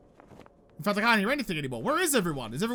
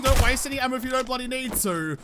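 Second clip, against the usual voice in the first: ordinary talk, nothing marked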